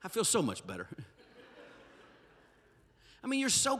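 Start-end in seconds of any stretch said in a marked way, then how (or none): 0.0-0.9 laughing relief. Real, not acted. Laughing after joke rant.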